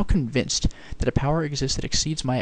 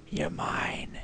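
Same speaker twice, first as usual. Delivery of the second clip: you're mine breathed as a whisper; 10 dB quieter than usual. Shown as a demonstration. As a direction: whisper it possessively to the prize. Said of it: "Oh, you're mine".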